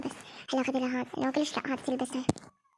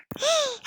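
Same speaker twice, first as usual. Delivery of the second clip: gasp; 10 semitones higher, 3 dB quieter than usual. Acted. Doll quarrel peaks.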